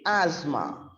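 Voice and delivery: ASTHMA said slowly as "AZ-ma"; silent letters. Shown as a demonstration. Teacher models silent letters for learners, slow ASTHMA as "AZ-ma". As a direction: slowly say "asthma" without any T. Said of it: "They are silent letters".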